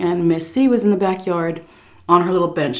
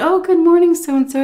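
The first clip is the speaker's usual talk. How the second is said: bright sing-song reply pitched much higher; polite greeting; 11 semitones higher, 4 dB louder than usual. Retold or imitated. Caregiver answering the daughter-in-law's greeting, sugary polite. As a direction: put on your sweetest polite voice. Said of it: "I said".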